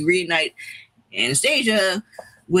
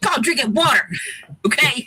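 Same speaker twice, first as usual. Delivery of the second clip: laughing tease; 8 semitones higher than usual. Real, not acted. Teasing thirsty cohost.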